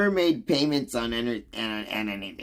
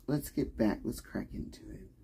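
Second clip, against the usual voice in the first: whispered, under the breath; hushed lead-in to the topic; whispered aside; 9 dB quieter than usual. Real, not acted. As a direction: slip it out in a whisper. Sets up the next topic.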